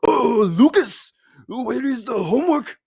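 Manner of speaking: scolding in a put-on Japanese accent. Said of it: "I'm just gonna have a Japanese accent".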